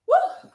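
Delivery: high, loud whoop of energy; excited woo. Real, not acted. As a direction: let out a big cheering woo. Coach cheering mid-workout.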